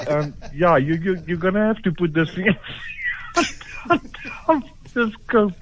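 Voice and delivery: high, laugh-broken explanation; talking through laughter. Real, not acted. Shop man explains the scope add-on, voice breaking into high laughter. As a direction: struggle to finish the sentence while cracking up.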